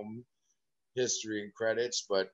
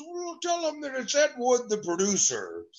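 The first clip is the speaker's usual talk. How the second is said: much higher, put-on caller voice, up top on the name; Ed Wood; 11 semitones higher, 4 dB louder than usual. Caller introduces himself to the boy, put-on high voice. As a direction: announce yourself grandly as the producer. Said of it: "He goes".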